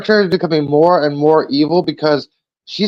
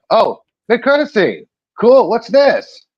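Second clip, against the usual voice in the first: higher-pitched, perked-up discovery; acted pleasant surprise; 6 semitones higher, slower than usual. Retelling hearing the credit scene start, pleasantly surprised. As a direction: act out perking up at a find.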